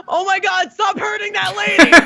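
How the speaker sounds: put-on high, alarmed child's shout; Ryan. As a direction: imitate a horrified little boy yelling. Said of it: "he's like".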